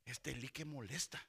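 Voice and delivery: low, soft grumble; grumbling. Acted. Sleepy Samuel complains about Elí, low grumbling. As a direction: grumble to yourself under the breath.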